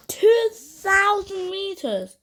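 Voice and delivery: slow, high, incredulous; disbelief. Real, not acted. Reacting to the climber's height.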